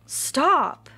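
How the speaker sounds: a sudden booming shout of stop; Old Turtle silences everyone; commanding stop. Acted. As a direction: halt the whole quarrel at once. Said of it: "It rumbled loudly like thunder".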